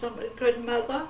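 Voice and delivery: understated mother's plea; retold begging. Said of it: "I said".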